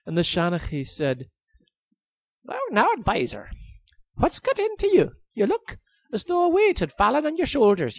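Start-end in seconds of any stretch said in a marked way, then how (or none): 2.4-8.0 high, sing-song Irish lilt; lilting Irish storyteller. Acted. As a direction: tease him in a lilting Irish brogue. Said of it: "the king's Shanachi, the storyteller".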